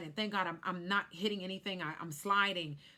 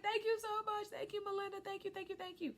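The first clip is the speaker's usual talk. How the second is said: much higher, fading, teary; tearful thanks; 13 semitones higher, 4 dB quieter, faster than usual. Retold or imitated. Thanking Melinda after surviving, tearful and high. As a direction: thank her through tears.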